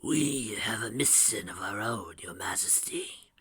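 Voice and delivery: slow, whispery character voice; hushed and drawn out, fading away; solemn mystic. A narrator's reading. Naga addresses the queen on entering, solemn.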